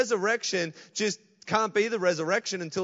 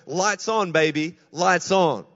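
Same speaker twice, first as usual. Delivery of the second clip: louder, slow, triumphant call; joyful declaration; 9 dB louder, slower than usual. Acted. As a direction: flick the lights on with your voice. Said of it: "Jesus can come in and just go".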